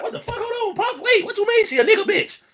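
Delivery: high mock voice of his puzzled son; confused son. The son cutting in on his father, high and confused. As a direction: pitch way up like a baffled kid. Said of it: "he go like".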